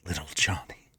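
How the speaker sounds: hushed whisper. Acted. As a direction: whisper it under your breath. Trying to be quiet.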